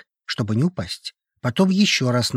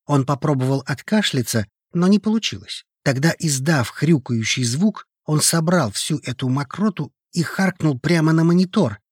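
ordinary delivery

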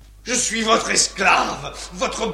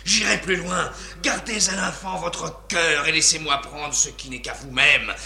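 ordinary talk

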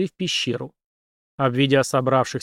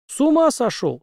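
high-pitched disbelief; aghast, pitch leaping up; appalled outburst; 13 semitones higher, 3 dB louder than usual. A narrator's reading. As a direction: exclaim it high, as if clutching your head. General clutches head, appalled at request.

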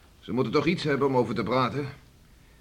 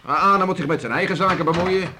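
angry outburst; 6 semitones higher, 6 dB louder than usual. Acted. Heated quarrel about beachcombing.

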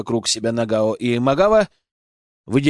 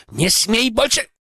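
higher, slow shrill warning; squealing warning; 9 semitones higher than usual. A narrator's reading. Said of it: "взвизгнул Акира".

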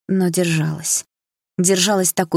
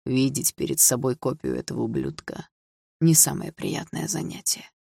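menacing stepfather; 3 semitones lower, 4 dB quieter than usual. A narrator's reading. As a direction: sneer it low so mother cannot hear. Stepfather sneers quietly. (отчим тихо и злобно язвит)

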